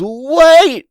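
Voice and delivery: high, loud yelp; sudden high-pitched shout; desperate cry. Acted. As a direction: cry out to halt a beating. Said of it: "Right as he goes".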